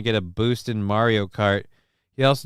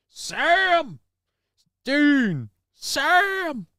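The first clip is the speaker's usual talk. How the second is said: high, far-off mock yell of Sam and Dean; a mock calling out of names; much slower than usual. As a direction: call the names out high and stretched.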